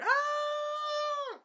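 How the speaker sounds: long, very high-pitched scream; imitated scream. Moriyasu punched away, drawn-out high mock scream. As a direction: squeal out a falling victim's cry. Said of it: "Moriyasu was like".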